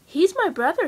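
put-on proud tiny kid introducing his brother; baby chipmunk. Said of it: "said one".

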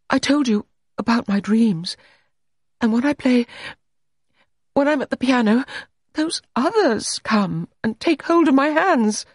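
high, mounting intensity; high-voiced, intense telling of dreams; haunted confession. A narrator's reading. Allegra confesses her haunted dreams.

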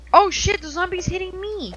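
high panicked yelp while under attack; panicked shout. Real, not acted. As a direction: yell in sudden alarm. Zombie attacks in game.

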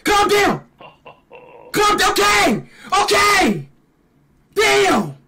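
high-pitched raging yells; cartoonish fury. Real, not acted. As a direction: scream at the screen in over-the-top rage. Raging at game enemy.

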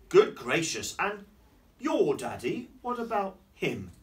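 loud, lifted storybook questioning; astonished story character. Acted. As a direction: ask with lively, exaggerated curiosity.

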